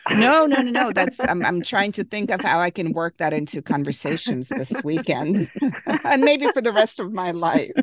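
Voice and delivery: amused, high and loud at first, breaking into laughs; real laughter bubbling between the phrases; laughing protest. Real, not acted. Host reacting to Canadian tuxedo term, laughing protest.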